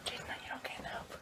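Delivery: hushed whisper so no one wakes. Real, not acted. Filming early morning beside sleeping people, whispering. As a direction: breathe it, barely voiced.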